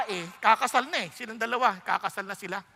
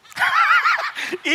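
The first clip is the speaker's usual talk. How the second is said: loud high laugh; 7 dB louder than usual. Real, not acted. Preacher laughing loudly at the congregation's answer. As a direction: burst of high shouted laughter.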